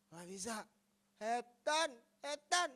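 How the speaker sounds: "Setan" forced out loud with a garbled first consonant; imitated slurred, loud "setan", pitch jumping up; stroke-slurred refusal. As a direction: blurt a mangled "setan" through a twisted mouth. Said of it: "Ngomong setan aja udah nggak benar".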